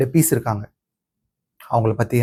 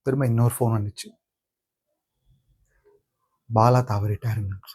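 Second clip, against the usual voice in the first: quiet grief; 4 dB quieter than usual. Acted. Recounts grandfather's death soon after.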